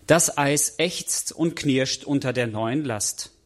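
ordinary delivery

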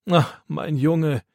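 tender sigh. A narrator's reading. Grandpa moved to tears.

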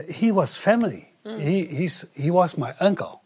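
ordinary talk

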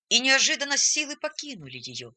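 ordinary delivery

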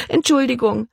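high, strained little apology; barely managed. A narrator's reading. Embarrassed Libby apologises mid-hiccup, barely getting it out. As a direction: squeeze it out between hiccups. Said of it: "brachte sie gerade noch hervor".

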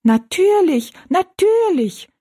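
very high, loud piping dwarf voices; echoing little people. A narrator's reading. Three dwarfs echoing the giant, high piping voices. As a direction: pipe it up high as an eager echo. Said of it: "wiederholten die drei Zwergenmenschen".

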